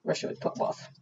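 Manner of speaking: plain reading voice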